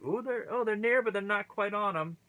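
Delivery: high-pitched spectator's oohing; excited reaction. Retold or imitated. Fan watching wrestlers land near the tacks, high excited reaction. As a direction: voice jumps high at a near miss.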